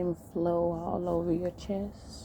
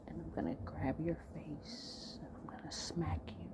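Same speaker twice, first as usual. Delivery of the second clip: hushed, intimate delivery with long pauses; seductive role-play; 11 dB quieter than usual. Acted. Man giving his partner intimate orders, hushed seduction.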